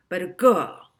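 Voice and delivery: insistent, trailing off; lightly voiced villain; pushy professor. A narrator's reading. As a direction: press the point, then fade. Professor pressing the clerk.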